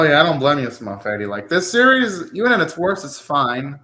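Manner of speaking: normal talking voice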